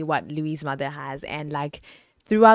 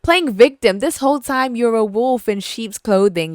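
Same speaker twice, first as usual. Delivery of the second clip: louder, higher indignant tirade; angry rant; 6 semitones higher, 8 dB louder than usual. Real, not acted. Reviewer fumes at the lying mother, loud. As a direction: call her out with fury. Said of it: "ranting at the character".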